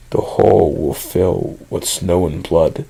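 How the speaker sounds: low, slow, eerie creature voice; creepy mimicking entity. A narrator's reading. Mimicking thing speaks through the cabin door, creepy and slow. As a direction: say it slowly and low, like something imitating a human. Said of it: "spoke to me in my own voice".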